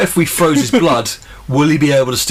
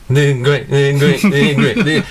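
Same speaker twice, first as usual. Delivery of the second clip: sleep-talking; slower than usual. Acted. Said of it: "in your sleep".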